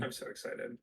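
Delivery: whispered excitement. Shown as a demonstration. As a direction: breathe it out in a whisper. Repeats the line, then whispers it.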